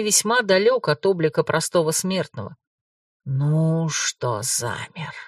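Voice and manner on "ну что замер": slow, low, teasing hush; mocking whisper. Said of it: "насмешливо шепнул призрак".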